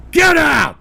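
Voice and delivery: high, loud, explosive yell; furious shout. Real, not acted. Streamer throwing out his chat, furious yell. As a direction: bellow it to drive them away.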